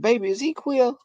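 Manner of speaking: grandmother. Retold or imitated. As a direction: mimic grandma gently prying. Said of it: "She said".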